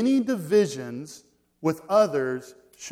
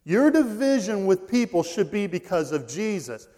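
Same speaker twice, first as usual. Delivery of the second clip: loud, high, driving sermon delivery; emphatic preaching; 4 semitones higher, 7 dB louder than usual. Real, not acted. Preacher urging the congregation about Jesus, loud and emphatic. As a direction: drive the point home at full force.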